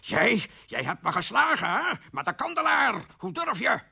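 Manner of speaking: high, outraged accusation. Acted. King accuses wife of hitting him, enraged. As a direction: build up the outrage in high pitch. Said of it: "riep de koning wit van drift".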